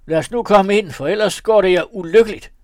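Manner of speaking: high, loud triumphant threat; shouting. A narrator's reading. Margrethe shouting at the house, loud. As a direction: crow over the scream, then threaten. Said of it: "lange Margrethe råbte".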